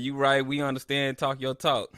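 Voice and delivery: usual speaking voice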